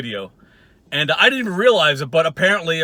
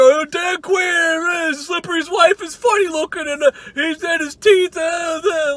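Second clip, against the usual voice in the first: slow, high mock shout; mocking Walter; 10 semitones higher than usual. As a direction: drag out a ridiculing yell, higher. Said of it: "you come back and you're".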